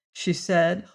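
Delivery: plain reading voice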